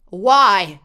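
put-on loud, insistent child's demand; demanding shout. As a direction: demand it at full volume, high. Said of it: "Damien demanded".

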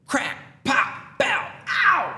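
high, climbing pops ending in a yelp; explosive sound effects. Acted. Husband re-enacting the shock hitting his wife, explosive sound effects.

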